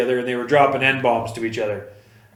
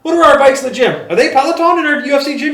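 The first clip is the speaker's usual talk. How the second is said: much higher, louder, fast call across the room; imitating a yell; 12 semitones higher, 5 dB louder than usual. Dana yelling to his people about gym bikes. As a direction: shout the question over to staff. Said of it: "Yells over to one of his people".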